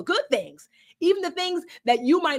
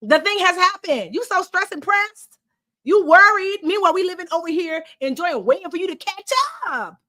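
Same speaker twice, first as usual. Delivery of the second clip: annoyed future self; 7 semitones higher, 4 dB louder than usual. Acted. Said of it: "She's like".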